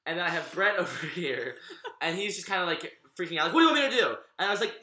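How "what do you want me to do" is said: high, loud frantic plea; panicked brother. Retold or imitated. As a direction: blurt it out in a panic. Brett freaking out at table.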